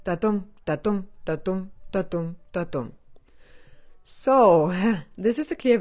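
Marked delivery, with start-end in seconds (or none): 0.0-2.9 "Da-dum" with the weight on dum each time; da-dum rhythm. Shown as a demonstration. Explaining the class title, chanting the iambic beat. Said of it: "Iambic pentameter".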